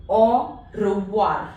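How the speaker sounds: "au revoir" slow, oi as wa; slow French au revoir. Shown as a demonstration. Teacher slowly drilling "au revoir" for the class. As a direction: draw out "au revoir" with wa. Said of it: "It is pronounced as wa".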